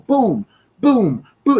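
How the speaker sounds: imitated gunfire; mock gunshots. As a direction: pop off like a gun.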